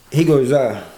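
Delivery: ordinary talk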